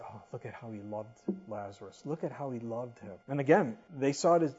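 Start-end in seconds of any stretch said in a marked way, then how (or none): 0.0-3.1 low, soft murmured observation; hushed onlookers. Acted. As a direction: quietly voice the moved bystanders. Voicing onlookers watching Jesus weep.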